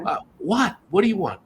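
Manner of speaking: high-pitched impatient phone reply; impatient retort. Retold or imitated. Acts friend's impatient phone reply.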